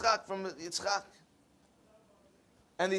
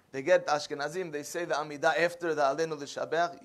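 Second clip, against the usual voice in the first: normal talking voice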